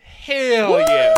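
loud, high cheer; excited cheer. Real, not acted. Cheering the headline.

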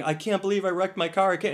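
high-pitched mock panic; mildly hammed-up upset driver; imitated distress. Retold distraught driver after crash.

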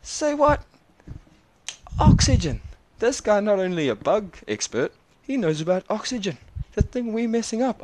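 high, loud astonished exclaiming; excited disbelief. Real, not acted. Vlogger marvels at the kid knowing about oxygen, high and loud.

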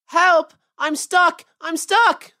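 high, loud, alarmed yells; frantic shouts, pitched well up; panicked cry for help. A narrator's reading. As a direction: cry out like a trapped little rabbit. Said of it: "he cried".

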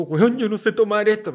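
high, loud panicked protest; new waiter panicking over twelve plates. Retold or imitated. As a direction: cry out in alarm at the plates.

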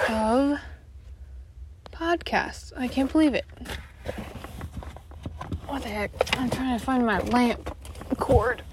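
usual speaking voice

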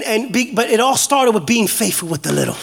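ordinary talk, nothing marked

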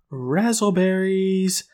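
high, drawn-out mock cheer; mock yell. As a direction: stretch out a high celebratory cry. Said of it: "jump up and they yell".

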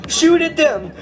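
panicked under fire. Pretend. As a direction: yell the orders as bombs fall. Orders under bombardment.

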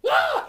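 high, loud mock shriek; mom's startled shriek. Son imitating his easily scared mom, high loud mock shriek. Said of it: "my mom's like".

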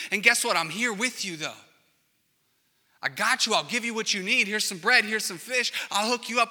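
loud, high call across the water. Retold or imitated. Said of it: "he's yelling out".

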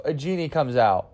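normal talking voice

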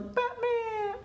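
put-on very high, drawn-out child's cry; excited kid. Kid in a toy commercial, high excited cry. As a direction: squeal it like a kid getting a toy. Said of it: "the kid was like".

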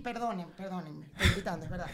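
suddenly low and soft; hushed apology. Real, not acted. Apologising to listeners for shouting, low and soft. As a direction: drop right down, sheepish.

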